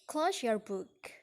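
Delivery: slow command, pitch falling to "book"; measured instruction with a falling end on "book"; falling intonation. Shown as a demonstration. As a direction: slowly let the voice drop on "book". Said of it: "The second example is in comment".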